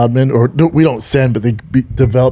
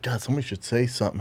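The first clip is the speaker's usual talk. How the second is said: hushed quote; 15 dB quieter, faster than usual. Retold or imitated. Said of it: "everyone's like".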